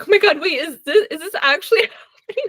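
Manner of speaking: high, fast, laughing "is this actually happening"; shocked disbelief. Retold or imitated. Reader at the book's climax, laughing in shocked disbelief. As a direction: spill it out half-laughing in shock.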